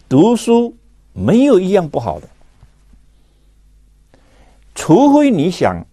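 normal talking voice

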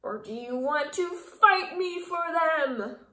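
slow, loud taunting challenge; grouchy challenge. A narrator's reading. Grouchy ladybug daring the friendly one, slow loud taunt. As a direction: dare the other bug to fight, slowly.

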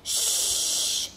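long, soft hushing hiss; shushing. Acted. Luke hushes Rascal, long shush. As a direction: shush the listener quietly.

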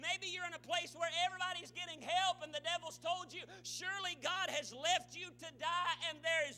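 full-force preaching. Real, not acted. Preacher speaks to doubters in church, fervent preaching. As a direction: build hard toward the devil's verdict.

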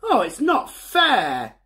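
a hint of a sulky little boy; sulky Bertie. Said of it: "thought Bertie".

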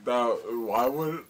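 usual speaking voice

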